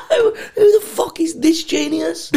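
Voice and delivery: high, slow, laughing-crying question; laughing mock disbelief. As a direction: crack up while asking. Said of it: "everyone was gonna go".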